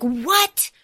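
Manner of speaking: breathy high whisper, slowed, imitating panic; freaking out. Clem panicking over the word nice, in a whisper. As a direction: hiss it out, confused and alarmed. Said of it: "she starts, like, freaking out".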